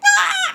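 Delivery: high startled scream. Retold or imitated. Bat flying at her face, a sharp scream. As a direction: shriek as something flies at you. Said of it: "And I was like".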